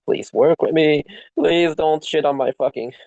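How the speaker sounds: real anger at the tablet; exasperated plea. Real, not acted. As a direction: drag it out in fed-up frustration. Cursing the tablet.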